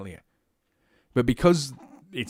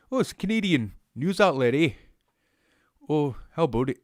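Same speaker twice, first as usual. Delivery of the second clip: put-on higher, lilting Canadian accent; mock Canadian accent; 4 semitones higher than usual. Host imagines locals naming a Canadian news outlet, mock Canadian accent. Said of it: "my Canadian accent".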